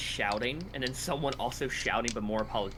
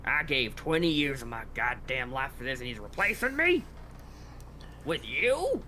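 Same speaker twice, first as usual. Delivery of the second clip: disbelieving anger. Acted. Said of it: "He goes".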